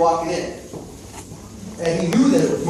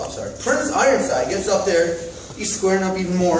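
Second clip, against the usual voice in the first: ordinary talk, nothing marked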